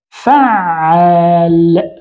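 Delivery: "فعال" very slow, doubled ayn held; نطق الوزن. Shown as a demonstration. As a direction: stretch out "فعال", leaning on the doubled middle consonant. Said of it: "هكذا على وزن". ("فعال" ببطء مع تشديد العين)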